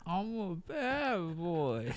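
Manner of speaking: muffled, slow with fingers in nose and mouth. Pretend. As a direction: drag it out, fingers stuffed in face. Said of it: "up your nose and in your mouth".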